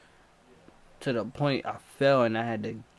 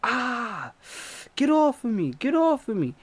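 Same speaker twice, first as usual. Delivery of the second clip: high, loud get off of me; desperate plea to be let go; panicked yell; 11 semitones higher, 4 dB louder than usual. Retold or imitated. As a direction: scream in pain and panic. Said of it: "I'm like".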